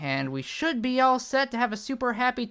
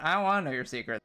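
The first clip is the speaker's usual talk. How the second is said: normal talking voice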